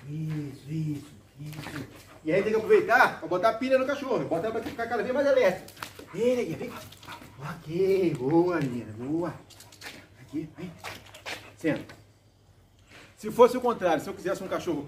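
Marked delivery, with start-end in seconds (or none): 0.1-1.9 slow soft praise; drawn-out quiet praise for a dog; talking to a dog. Real, not acted. 6.1-11.9 coaxing the puppy; talking to a dog. Real, not acted. Calling and praising the dog.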